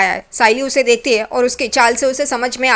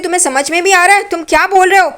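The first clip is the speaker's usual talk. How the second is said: higher, exasperated scolding; exasperated wife; 6 semitones higher than usual. Retold or imitated. Wife losing patience with her drunk husband, high and exasperated. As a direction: snap at a babbling drunk.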